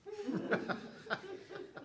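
quiet high breathy laugh; soft laugh. Real, not acted. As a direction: chuckle softly at the words. Laughs while reading quote.

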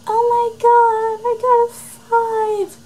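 very high, slow mock-ecstatic gasp; fake excited squeal. Pretending to react to her test scores, high slow fake squeal. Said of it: "so I can be like".

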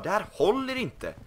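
mock TV carpenter scolding the builder; Arga snickaren. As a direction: boss the house around like a grumpy carpenter. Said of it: "Vi får ringa arga snickaren snart".

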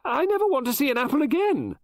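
very high, loud and overwrought; near hysterical. Acted. Mrs Oliver telling Poirot she hates apples, near hysterical. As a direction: spill it out close to panic. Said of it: "I was getting hysterical".

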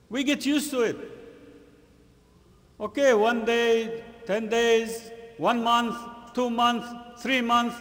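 loud, high, insistent sermon count; forceful preaching. Real, not acted. Preacher warning the congregation about getting used to it, forceful preaching. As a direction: hammer out each stretch of time.

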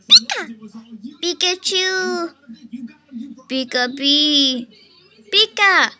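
high, loud squeaky Pika cries; Pikachu voice. Pretend. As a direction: yelp Pika like the little electric mouse. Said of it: "Pikachu, but it's, like, pretty horrible".

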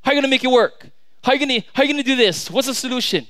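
fast, high, pleading rush; impatient prayer. Acted. People coming before the Lord with demands, rushed and impatient. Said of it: "we just say".

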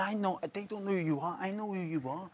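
quietly confiding fan; hushed fan quote. Retold or imitated. Fan quoted softly from front row.

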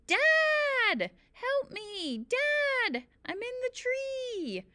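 high, drawn-out screams for help; screaming. A narrator's reading. Boy screams to his dad from the tree, screaming. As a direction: holler for rescue, pitch way up. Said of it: "screamed as loudly as I could".

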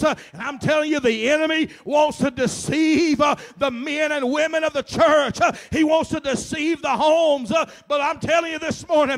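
high, loud, with a gasped uh ending each phrase; fiery preaching. Real, not acted. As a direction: preach it full force to the congregation. Preaching at full force.

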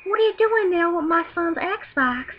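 put-on high, drawn-out old-lady voice. Old lady catching Xbox borrower, scolding voice. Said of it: "this old lady comes in and goes".

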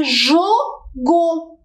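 "jogo" in two slow, separate beats, jo, go; split into syllables. Shown as a demonstration. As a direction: break "jogo" after "jo", stretching each syllable. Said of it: "se nós separarmos em sílabas". ("jogo" separado em sílabas, devagar: jo, go)

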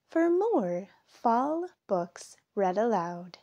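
usual speaking voice

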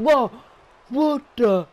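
high, loud disbelieving cry; shocked. Real, not acted. Commentator stunned by a surprise move, loud and high. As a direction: jolt of real shock.

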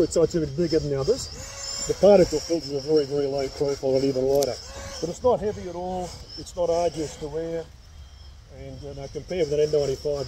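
muffled behind respirator; speaking inside mask; through a face mask. Real, not acted. Seen 2: Clear half-face respirator covers mouth and nose throughout; absent in references.

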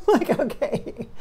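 amused laugh into a slow okay. Real, not acted. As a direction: laugh it off, caught off guard. Laughing at spirit's bluntness.

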